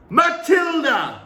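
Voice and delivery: long, high, loud bark of a name. A narrator's reading. Trunchbull barking at Matilda to stand. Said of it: "she barked".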